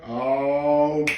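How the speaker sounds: normal talking voice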